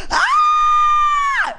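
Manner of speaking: piercing high scream of fright; hammed-up horror-film scream; terrified shriek. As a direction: shriek at the top of the voice. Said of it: "And I was like".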